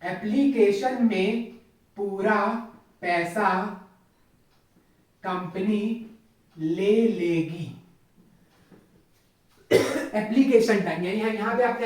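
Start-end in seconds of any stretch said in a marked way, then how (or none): 0.0-7.8 slow, word by word, so students can write; slow dictation. Shown as a demonstration. Teacher to students taking notes, slow dictation. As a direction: dictate each word slowly with pauses. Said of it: "लिखो आप लोग भी।".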